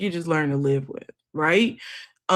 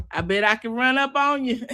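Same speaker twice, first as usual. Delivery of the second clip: ordinary talk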